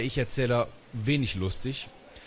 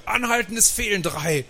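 high, urgent yell; alarmed, high-pitched granny shout; bellowed order; 10 semitones higher than usual. A narrator's reading. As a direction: yell for the driver to stop, pitched way up. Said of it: "brüllte Oma Inge".

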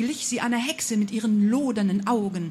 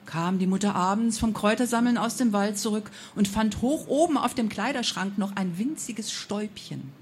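plain reading voice

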